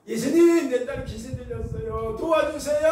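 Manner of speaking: loud, high pleading wail; acted mother begging at full voice; desperate cry for help. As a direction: cry out with drawn-out endings. Woman cries out to Jesus.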